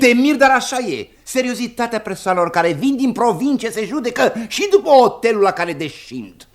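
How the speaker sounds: high-pitched, pompous lecturing retort; emphatic insistence. Acted. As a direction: insist shrilly that you are right. Husband insists, rebutting wife's mockery. (insistență apăsată, pe ton înalt)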